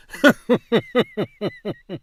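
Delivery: long chuckle trailing off; bubbly laugh, loud at first, fading. Real, not acted. Speaker amused by own coming slip of the tongue, laughing.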